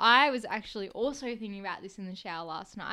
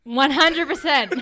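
higher, louder, slow and drawn out; loud delighted one hundred percent; emphatic agreement; 4 semitones higher, 15 dB louder, slower than usual. Real, not acted. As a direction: really lean into the agreement.

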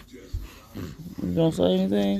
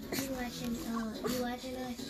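whispering; 5 semitones higher, 13 dB quieter than usual. Real, not acted. Very soft to baby.

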